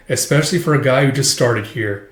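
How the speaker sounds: ordinary talk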